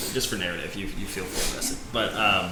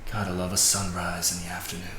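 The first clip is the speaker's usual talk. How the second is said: sultry man's voice; 5 dB quieter than usual. A narrator's reading. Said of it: "somewhat sultry man's voice".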